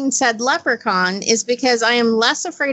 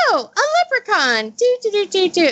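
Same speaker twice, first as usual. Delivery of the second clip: high, carefree humming; happy sing-song; 9 semitones higher than usual. Retold or imitated. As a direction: hum happily, unaware. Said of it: "I was like".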